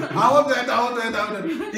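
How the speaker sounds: ordinary talk, nothing marked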